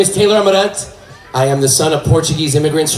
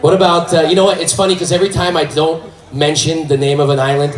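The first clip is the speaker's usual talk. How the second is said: normal talking voice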